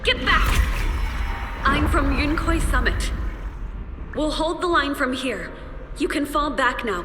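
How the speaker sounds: high-pitched battlefield order; urgent command. Acted. Comrade covers the squad's retreat, urgent calling.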